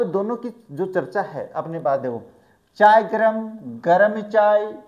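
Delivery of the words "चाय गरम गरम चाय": loud sing-song call of a tea seller; tea vendor call. Shown as a demonstration. Teacher mimics a platform tea seller calling loudly. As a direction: call out like a railway chaiwala. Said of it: "सुना है क्या कभी ऐसे?".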